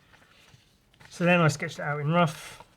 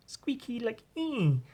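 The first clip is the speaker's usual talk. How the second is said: squeaky; 9 semitones higher, 4 dB quieter, slower than usual. Shown as a demonstration. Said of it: "Nice squeaky little cute goblin".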